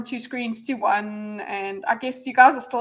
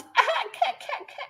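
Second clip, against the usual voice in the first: high cackling laugh; 13 semitones higher, 4 dB quieter than usual. Real, not acted. Laughs at own pun.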